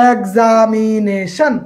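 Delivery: "Examination" stretched very slowly, syllable by syllable; drawn-out syllables. Shown as a demonstration. Teacher showing a polysyllable example, "examination" slowly stretched out. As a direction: draw out each vowel of "examination" without breaking it. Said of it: "তিনের অধিক বারে উচ্চারণ".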